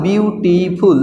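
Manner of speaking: "বিউটিফুল" split into three drawn-out parts, বিউ-টি-ফুল; syllable by syllable. Shown as a demonstration. Teacher showing pupils the syllables, "বিউটিফুল" said part by part. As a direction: break "বিউটিফুল" into its syllables, stretching each. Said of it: "তিন syllable".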